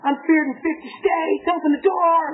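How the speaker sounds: high-pitched, acted furious demand; angry screaming. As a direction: rant at a locked door, hammed up. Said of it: "I'm screaming and I'm angry".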